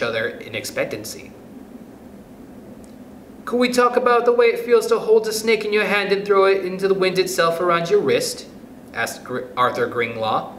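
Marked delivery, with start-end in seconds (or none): 3.4-8.5 put-on high-pitched child's question; pupil's voice. A narrator's reading. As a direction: ask like a schoolboy, pitched up high. Pupil voiced asking the teacher.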